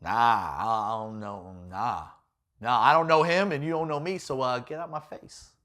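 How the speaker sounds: low, mumbled denial; evasive Peter. Retold or imitated. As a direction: shrug it off, don't look at him. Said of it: "Peter's like".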